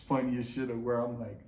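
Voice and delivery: ordinary talk, nothing marked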